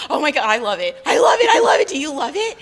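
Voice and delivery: rising to a very high, loud squeal; excited gushing. Retold or imitated. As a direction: hype up the new hairdo. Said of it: "she's like".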